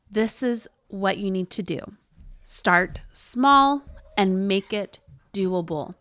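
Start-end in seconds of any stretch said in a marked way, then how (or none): none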